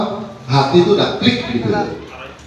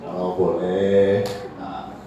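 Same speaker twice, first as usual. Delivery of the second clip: low, soft, slow asking; hushed, deferential suggestion; polite request; 10 semitones lower, 9 dB quieter, slower than usual. Shown as a demonstration. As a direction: ask tentatively, very gently. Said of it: "nggak ada salahnya kamu bilang".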